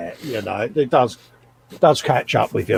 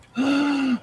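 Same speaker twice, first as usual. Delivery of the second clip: mock gasp; 11 semitones higher, 4 dB quieter than usual. Mock shock at cereal story.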